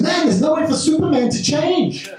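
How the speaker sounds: put-on high piping kid voice; mock child voice; kid's voice. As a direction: squeak it out like a little boy.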